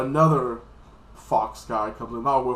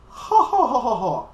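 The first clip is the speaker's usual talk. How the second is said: high, loud, slow acted laughter; hammed-up gloating ha-ha; mocking villain laugh; 10 semitones higher, 7 dB louder, slower than usual. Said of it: "And the guy's like this".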